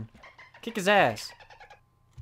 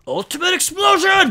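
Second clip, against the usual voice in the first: much higher, louder hammed-up shout; mock dramatic attack call; mock battle cry; 12 semitones higher, 9 dB louder than usual. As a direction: yell it like an anime attack. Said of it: "he was just like".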